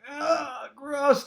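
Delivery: put-on high, crying little-kid groan; crying disgust. Boy stepped in dog mess on Easter, crying disgust. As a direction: whimper it like a kid about to cry. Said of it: "I start crying".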